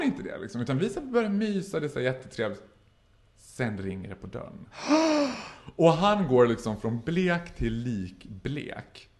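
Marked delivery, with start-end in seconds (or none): 4.7-5.7 big high gasp of alarm; shocked gasp. Acted. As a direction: suck in air at a sudden fright. Reacting to doorbell in story.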